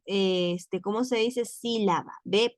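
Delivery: ordinary talk, nothing marked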